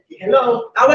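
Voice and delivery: ordinary talk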